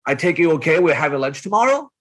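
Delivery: statement ending on a rising tune; upward inflection. Shown as a demonstration. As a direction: make it sound like a question by going up. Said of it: "my voice inflects upward".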